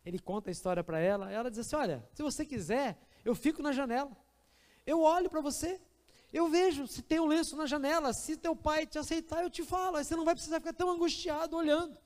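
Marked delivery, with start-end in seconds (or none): 1.8-12.0 kind stranger. Acted. Woman offers help on train.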